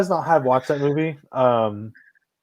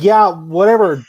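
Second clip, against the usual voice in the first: mock clever comeback; 3 semitones higher, 5 dB louder, much slower than usual. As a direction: toss out mom's comeback, drawn out. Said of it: "trying to make a clever comeback".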